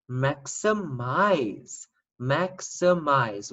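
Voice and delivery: Maximize stretched out very slowly; slow sounding-out. Shown as a demonstration. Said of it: "that doesn't sound like a word either".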